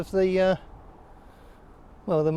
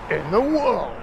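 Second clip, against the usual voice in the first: light Top Gear presenter drama; hint of a dramatic TV presenter; Jeremy Clarkson. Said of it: "or as Clarkson would say".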